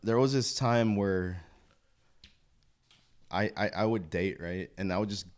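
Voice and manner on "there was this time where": ordinary talk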